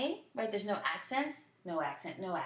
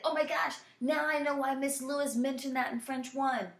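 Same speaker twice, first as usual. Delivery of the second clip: higher-pitched mock amazement; excited student realization; 4 semitones higher than usual. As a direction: play a student suddenly getting it. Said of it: "You'll be like".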